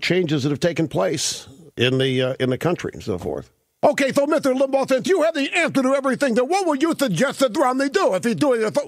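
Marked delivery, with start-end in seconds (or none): 3.8-8.8 high, fussy mock voice putting a question; mocking challenger. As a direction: needle the host in a high put-on voice. Mimics a challenger questioning the host.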